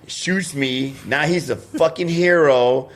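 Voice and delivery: ordinary delivery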